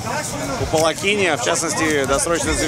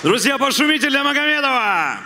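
shouting to crowd; 8 semitones higher than usual. Real, not acted. Hyping crowd in the cage.

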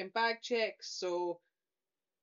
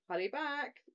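mimicked ride lady; 7 semitones higher, slower than usual. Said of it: "mimicking the".

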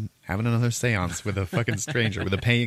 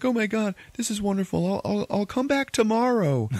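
thrilled customer; 14 semitones higher than usual. Retold or imitated.

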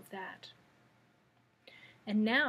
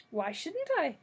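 a high, crisp retort; sharp, clear voice; 6 semitones higher than usual. A narrator's reading. The hen answers Dorothy in a sharp voice. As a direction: snap back lightly, pleased with yourself. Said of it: "in a sharp, clear voice".

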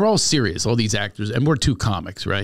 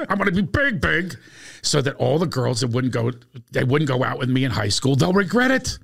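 earnest wannabe pleading to be famous; imitating Bruce Smirnoff; 4 dB louder than usual. Bruce introducing himself to the class, eager and climbing. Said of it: "He goes".

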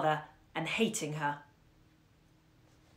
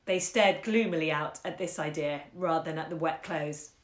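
ordinary delivery

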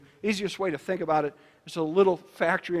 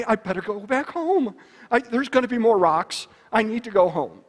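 higher, louder, fretful little pig; anxious Piglet, pitched up and pushed; worried Piglet; 5 semitones higher, 5 dB louder than usual. Acted. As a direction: panic gently about more rocks. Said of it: "Piglet would get all worried".

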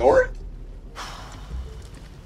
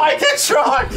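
shouting while being tickled; 6 semitones higher than usual. Real, not acted. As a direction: yell through the tickling. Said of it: "He's tickling me".

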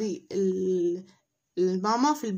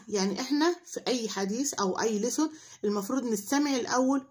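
usual speaking voice

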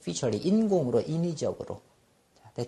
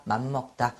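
normal talking voice

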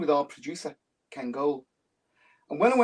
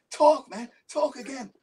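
high-pitched mimicry of amazed locals; excited American fans; 9 semitones higher than usual. Americans marvelling at the band's accents, excited mimicry. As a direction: play a starstruck fan begging to hear more. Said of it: "Especially when we talk".

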